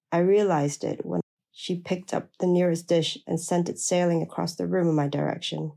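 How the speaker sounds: ordinary delivery, nothing marked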